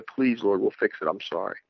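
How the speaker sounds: pleading, sinking to a soft murmur; fading plea. Retold or imitated. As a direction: let the apology fade out softly. Retold plea to God, fading.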